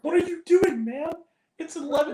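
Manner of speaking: very high-pitched mock exasperation; losing his mind. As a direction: imitate a frantic, disbelieving friend. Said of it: "was losing his mind".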